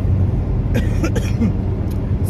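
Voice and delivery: dry morning cough; clearing a groggy throat; real cough. Real, not acted. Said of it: "Excuse my morning".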